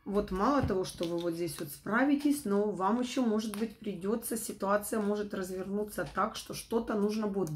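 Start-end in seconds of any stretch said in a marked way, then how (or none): none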